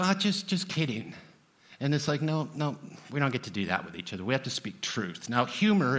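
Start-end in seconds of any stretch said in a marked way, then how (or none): 0.0-1.1 mock just kidding. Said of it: "then you get to go".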